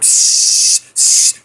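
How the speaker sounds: imitated turtle hiss, drawn-out sibilant. Friend retells the turtle facing them, hissing. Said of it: "he's hissing".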